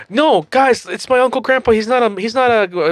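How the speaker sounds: high-pitched cartoon pleading; acted protest. Acted cartoon character pleading with friends.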